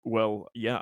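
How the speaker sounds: usual speaking voice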